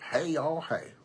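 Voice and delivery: put-on playful Southern cowboy accent. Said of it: "That sound like a cowboy".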